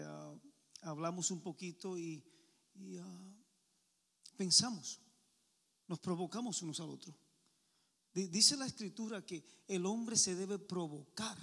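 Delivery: usual speaking voice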